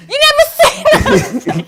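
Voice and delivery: high mock Italian; Italian accent. Comedian demonstrates selling the line, Italian accent. Replays her improv line.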